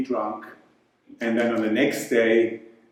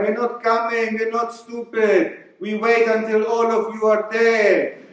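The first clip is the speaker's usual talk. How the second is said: mock Mexican accent; 8 semitones higher than usual. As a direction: play the smug Mexican taunting Donald. Mexican character speaks to Trump.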